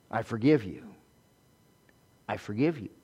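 low, soft and sincere; hushed, heartfelt forgiveness; quiet forgiveness. Shown as a demonstration. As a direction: drop to a gentle, quiet pardon. Models forgiving after an emphatic build.

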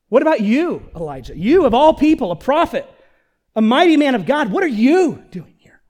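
loud, high, incredulous challenge; accusing God. Acted. God confronting his runaway prophet Elijah, loud and accusing. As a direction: hit each phrase like an accusation. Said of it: "we don't know how God asked him that".

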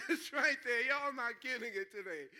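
loud, laughing tease; real amused laughter in the voice. Real, not acted. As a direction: talk through a laugh. Laughing after his exit joke.

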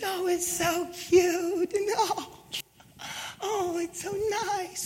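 high, slow, mock-sweet "so cute"; sarcastic cooing. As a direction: gush sarcastically like a greeting card. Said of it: "Sorry, I'm being so sarcastic".

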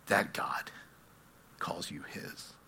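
hushed, fading to near silence; very soft, intimate close; reverent whisper. Real, not acted. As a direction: whisper it slowly to the congregation. Hushed climax of the sermon.

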